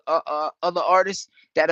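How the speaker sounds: usual speaking voice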